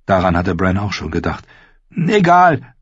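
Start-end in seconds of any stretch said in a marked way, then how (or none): 1.9-2.7 put-on high, loud boyish dismissal; confident. A narrator's reading. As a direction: brush it off with assurance. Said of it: "sagte er zuversichtlich".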